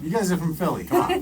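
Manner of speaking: playful outraged come on; mock outrage. Real, not acted. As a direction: throw it back at them, laughing indignation. Playfully turning the accent jibe back.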